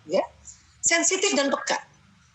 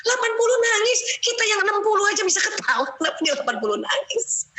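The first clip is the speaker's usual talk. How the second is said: mock bewilderment at the crying brother; wondering aloud why he cries; amazed disbelief; 9 semitones higher than usual. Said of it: "watak lain suka heran".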